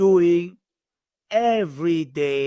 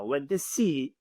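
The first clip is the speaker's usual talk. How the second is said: ordinary talk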